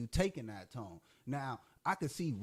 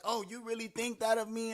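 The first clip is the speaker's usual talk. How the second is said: higher, put-on hurt girlfriend voice; mock offended girlfriend; 8 semitones higher than usual. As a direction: play the wounded innocent, pitched up. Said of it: "and she like".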